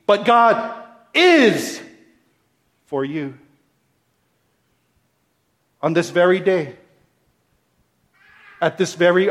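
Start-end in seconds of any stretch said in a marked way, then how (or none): 0.1-3.4 God is drawn out slowly, is held; stress on is. Shown as a demonstration. Preacher dwelling on is for his church. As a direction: stretch the word is. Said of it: "Second word, is".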